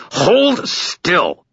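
high, strained snarl. A narrator's reading. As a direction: snap the command out at full strain. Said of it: "I snarled".

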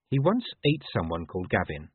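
ordinary delivery, nothing marked

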